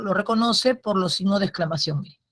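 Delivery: normal talking voice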